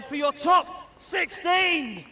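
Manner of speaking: slow, drawn-out hype yell; crowd hype shout. Real, not acted. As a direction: whip up the crowd.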